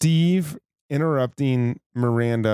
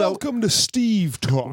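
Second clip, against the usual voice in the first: announcer voice; 5 semitones higher, slower than usual. Pretend. Mock radio show intro.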